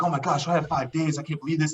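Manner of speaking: retelling his own thrill at five days; excited. Retold or imitated. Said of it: "I'm all excited".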